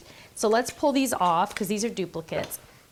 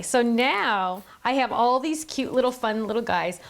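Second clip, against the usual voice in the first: usual speaking voice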